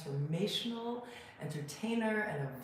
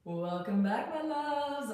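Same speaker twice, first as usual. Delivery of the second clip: podcast host intro; 8 semitones higher, 5 dB louder, slower than usual. Acted. Opening the podcast episode.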